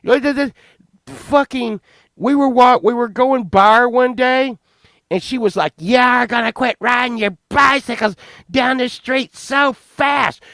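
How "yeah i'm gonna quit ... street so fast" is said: high-pitched imitation of an irate woman; mock scolding neighbor. Woman complains about bicycles racing down the street, mock scolding. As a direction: squawk it as an indignant neighbor. Angry neighbor voiced in story.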